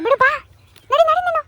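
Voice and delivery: high, loud hurry-up; loud high order to walk on; shooing along. Real, not acted. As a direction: chase her along with a sharp call.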